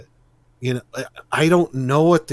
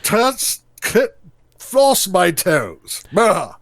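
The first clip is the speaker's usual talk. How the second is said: high, loud, drawn-out mock command; slow, strained, haughty put-on voice; pompous master; 7 semitones higher, 5 dB louder, much slower than usual. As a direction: ham up an absurd lordly order. Hamming up the pompous master bit.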